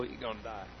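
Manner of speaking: mock the doubter's quiet gloom; muttered aside. Mocking doubter's muttered remark.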